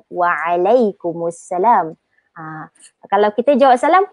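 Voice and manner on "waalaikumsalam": Waalaikumsalam slow and lower, pressing "alai"; stressed ain. Shown as a demonstration. Teacher showing where to stress, slow low Waalaikumsalam. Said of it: "dekat situ yang kita kena tekankan".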